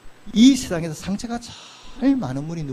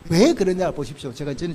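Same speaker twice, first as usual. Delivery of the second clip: ordinary talk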